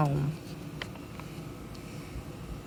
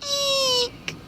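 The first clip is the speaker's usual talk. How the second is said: tiny high eek; a little ladybug's fright; frightened squeak. Acted.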